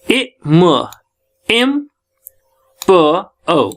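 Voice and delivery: ए, म, then blended एम, then प and ओ apart; sounding out. Shown as a demonstration. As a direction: sound out ए म एम प ओ with pauses. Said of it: "ये वाली sound आपको".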